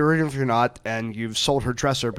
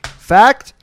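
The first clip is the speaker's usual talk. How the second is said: game show host; 6 dB louder, much slower than usual. Acted. Host asks contestant.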